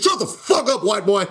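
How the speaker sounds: mock a hard inmate shutting him down; lightly voiced jail bully; tough inmate. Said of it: "Be like".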